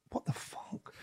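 whispered in shock; breathless whisper. Retold or imitated. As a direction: barely breathe it out, stunned. Stunned inner reaction in ringside.

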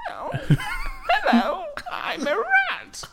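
high, slow squeaky rat voice, laughing. Pretend. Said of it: "It's like your rat's voice".

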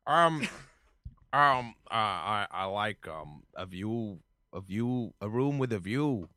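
hesitant, soft, low statesman; lightly voiced politician searching for an answer; John Kerry. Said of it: "uh, Mister Kerry".

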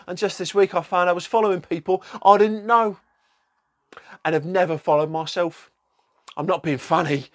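lightly voiced mock protest; Rebecca's reply. Protesting her Insta was hacked, lightly put-on voice. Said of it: "So she's replied".

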